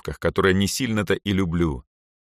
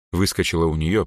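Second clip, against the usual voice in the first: ordinary delivery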